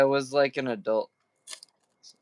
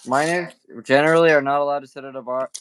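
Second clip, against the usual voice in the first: usual speaking voice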